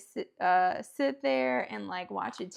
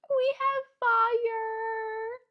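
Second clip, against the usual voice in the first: much higher, slow, drawn-out cheer; excited, stretched-out cheer; thrilled; 11 semitones higher, 6 dB louder, much slower than usual. Real, not acted. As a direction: celebrate the little fire like a win. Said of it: "I'm so excited".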